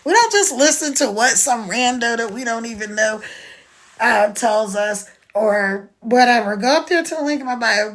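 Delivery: ordinary talk, nothing marked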